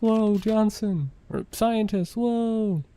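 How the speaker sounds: high, drawn-out whoa; mock amazement. Player imagining a reaction to his friend turning up, mock amazement. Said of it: "It's like".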